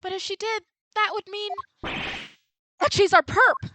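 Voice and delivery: a witness batting her lashes, voiced high and loud; sweet witness voice. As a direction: play the sugary suspect who swears she's harmless.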